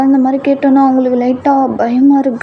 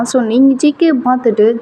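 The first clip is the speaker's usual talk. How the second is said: usual speaking voice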